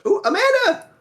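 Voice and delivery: excited. Real, not acted. Excited at seeing fan art.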